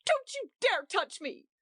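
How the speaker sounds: high, cried-out warning; a wife crying out at the host not to touch her. A narrator's reading. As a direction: fend him off with your voice. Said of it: "cried Edith".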